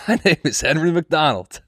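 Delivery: a hint of the stranger repeating his name; repeating old man. Comic laughs and imitates the old man repeating his name, lightly voiced. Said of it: "he was just saying".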